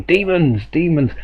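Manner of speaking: high-pitched imitation of an exasperated shout; imitating a painter shouting at his drawing; mock shouting. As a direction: call it out high, only half-shouted. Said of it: "just shouting out".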